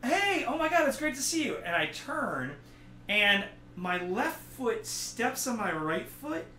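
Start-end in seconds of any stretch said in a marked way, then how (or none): 0.0-1.6 high-pitched, overeager hello; hammed-up delight, pitched well up; gushing greeting. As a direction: act out your own excited greeting. Own greeting acted in story.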